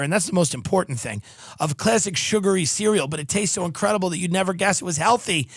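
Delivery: normal talking voice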